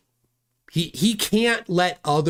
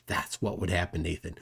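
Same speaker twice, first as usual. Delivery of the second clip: hushed threat; 8 semitones lower, 9 dB quieter than usual. Real, not acted. Streamer addressing Nathan, hushed menacing tone. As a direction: drop much softer and lower, a quiet menacing aside. Repeats line, second take hushed.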